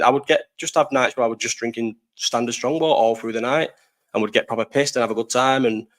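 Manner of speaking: ordinary talk, nothing marked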